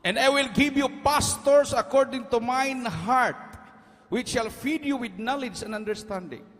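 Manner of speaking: high, loud, slow preaching; preaching at full force. Acted. Preacher thundering scripture to his congregation, full preaching force. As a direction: proclaim the verse to the congregation.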